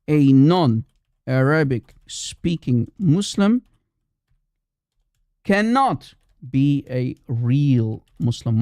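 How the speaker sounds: very slow, every word set apart; slow emphatic repetition. Real, not acted. Repeats it slowly for emphasis.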